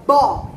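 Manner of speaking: "bó" said loud and open as one syllable; syllable demo. Shown as a demonstration. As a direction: punch out "bó" like showing a child. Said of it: "a primeira sílaba".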